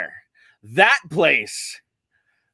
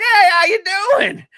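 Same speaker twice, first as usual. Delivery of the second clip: imitating family; 4 dB louder than usual. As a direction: chirp it like the folks back home. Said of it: "they're like".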